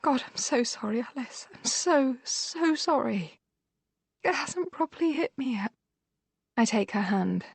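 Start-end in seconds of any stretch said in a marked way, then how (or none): 0.0-5.7 tearful. Acted. Narrator comforts injured sister.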